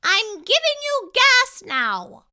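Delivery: very high, loud squeaky mouse voice; a tiny polite mouse dentist; little mouse speaking up. A narrator's reading.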